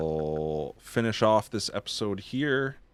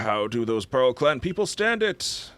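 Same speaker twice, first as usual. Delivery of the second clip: NPC voice; 5 semitones higher, 5 dB louder than usual. Acted. Voices game character dialogue.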